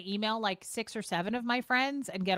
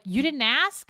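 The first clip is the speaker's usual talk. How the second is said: surprised friend; 4 semitones higher, 7 dB louder, slower than usual. Retold or imitated. Friends surprised she didn't ask.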